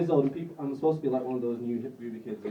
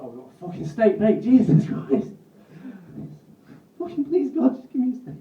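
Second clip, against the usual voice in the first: desperate shout; 8 semitones higher, 11 dB louder than usual. Retold or imitated. Thanos begging God for a steak, desperate loud yell. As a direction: beg for a steak at the top of your lungs. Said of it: "just be like".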